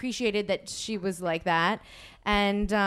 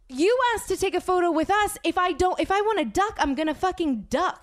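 higher-pitched, indignant retort; heated argument; 5 semitones higher than usual. Retold or imitated. Guest retelling her fight with a bossy fan, heated. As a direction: argue back, pitch raised and emphatic.

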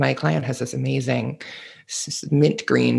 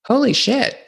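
surprised; 11 semitones higher, slower than usual. Real, not acted. Reacting to her reaction.

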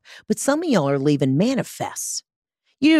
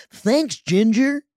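drawn-out, put-on DJ sign-off; radio disc jockey; 3 semitones higher, much slower than usual. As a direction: thank the weather reporter like a hammy radio host. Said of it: "I love that voice".

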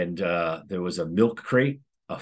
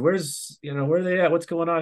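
higher-pitched mock bewilderment; puzzled asking; 10 semitones higher than usual. Principal asking the other AP where everyone went, puzzled. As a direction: act out a puzzled, urgent question.

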